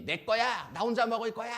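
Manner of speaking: high, loud mock child outburst; 욕심부리는 아이. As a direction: shout possessively like a greedy kid. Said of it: "얼마나 욕심이 많습니까". (욕심쟁이 아이처럼 높고 크게 외치며)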